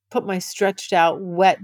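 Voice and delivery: normal talking voice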